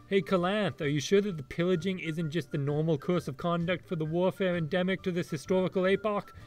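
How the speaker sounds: normal talking voice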